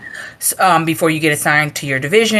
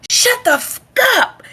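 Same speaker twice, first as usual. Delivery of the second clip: imitated yell. Instructor silencing a talking recruit, imitated yell. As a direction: cut off the curse, then hit the last word. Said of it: "yell at you and cuss you".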